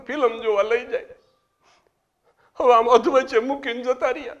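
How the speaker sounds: high, rising wail of a widow; hammed-up widow complaining through tears; mock weeping widow. Widow crying to the president about films, high mock wail. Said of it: "રોતા રોતા મને કે".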